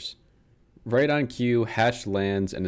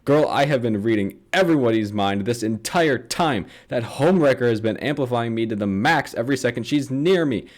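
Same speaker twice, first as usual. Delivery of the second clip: put-on a ranting girlfriend, climbing from sassy to a loud, high outburst; building rant; 5 semitones higher, 6 dB louder than usual. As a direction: talk faster and pile it up until you explode. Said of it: "Taylor's like".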